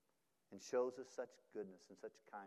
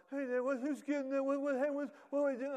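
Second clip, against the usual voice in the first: put-on high, confused kid voices; bewildered children asking over and over; clamoring kids; 8 dB louder than usual. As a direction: pester dad like puzzled kids.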